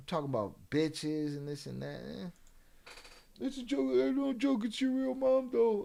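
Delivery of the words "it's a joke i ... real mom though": high mocking voice, a mean joke; a higher teasing voice aimed at the troll; mock high taunt. Real, not acted. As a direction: tease him in a put-on high voice.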